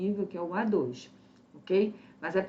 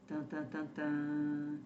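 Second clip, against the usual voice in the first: suspense sound; 5 semitones lower, 8 dB quieter, slower than usual. Acted. Quiz suspense.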